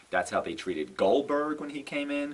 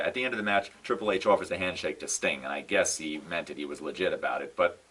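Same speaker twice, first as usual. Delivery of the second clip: normal talking voice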